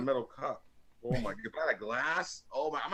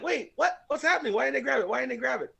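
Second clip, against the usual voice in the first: baffled; 11 semitones higher than usual. Retold or imitated. Retelling his confusion watching game.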